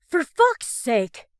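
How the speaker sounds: slow, loud furious yell; frustrated scream. A narrator's reading. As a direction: explode in frustration. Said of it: "Ross screams with pure frustration".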